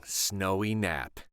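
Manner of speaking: plain reading voice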